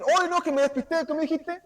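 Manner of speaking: tono agudo de burla; Dios despistado burlón. Pretend. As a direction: imitando a alguien distraído. (voz aguda de despiste fingido)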